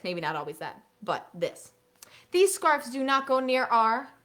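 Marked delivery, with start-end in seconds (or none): 2.3-4.1 teacher fill-in prompt. Shown as a demonstration. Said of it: "they fill in the blank".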